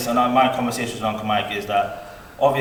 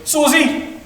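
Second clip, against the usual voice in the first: much higher, louder indignant uncle; Uncle Mike protesting; 11 semitones higher, 9 dB louder than usual. Retold or imitated. Uncle protesting to the boys' mother, high indignant. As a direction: complain to Susie, loud and high, mock outraged. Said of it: "he goes".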